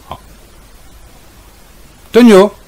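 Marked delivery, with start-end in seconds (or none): 2.1-2.5 much higher, loud call; real alarmed shout, much higher and louder; shouting the dog. Real, not acted. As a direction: yell his name in alarm. Calling the dog again.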